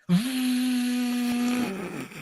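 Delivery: loud, high vocal drill whine; imitated drill noise. Neighbour mimics drilling that starts with the meeting, loud high whine. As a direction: blast out a piercing mechanical drone.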